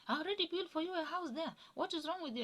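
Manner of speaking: mother-in-law shouting in mock fury; imitating angry shouting. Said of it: "And she was shouting".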